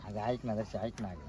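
low, soft pleading; soft coaxing. Acted. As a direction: coax her quietly to listen. Pleading with her as she leaves. (हळुवार, खालच्या आवाजात विनवणी)

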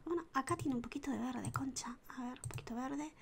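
soft annoyed whisper; quiet whispered grumble; whispered curse. Real, not acted. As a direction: whisper the curse under your breath. Annoyed aside about green.

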